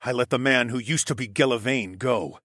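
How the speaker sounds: usual speaking voice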